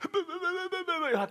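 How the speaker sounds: high-pitched imitated blubbering wail; mock sobbing babble. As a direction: blubber wordlessly, too upset to talk. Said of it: "bawling, crying. Couldn't talk".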